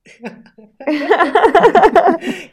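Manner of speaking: loud high burst of laughter; big laugh. Real, not acted. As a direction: laugh out hard. Laughing at the killer pepperoni joke.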